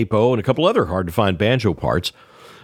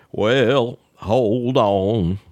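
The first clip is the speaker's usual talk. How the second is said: lightly voiced, unhurried old Carolina man; a hint of Earl's slow, deliberate phrasing; Earl's slow talk; much slower than usual. Earl on the phone to Ned, lightly voiced slow drawl. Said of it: "Earl spoke very slowly and deliberately".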